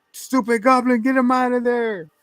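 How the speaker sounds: high-pitched exasperated villain outburst; acted angry order. Acted movie boss yelling at Goblin.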